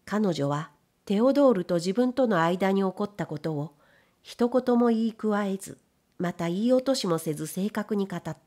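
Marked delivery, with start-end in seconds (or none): none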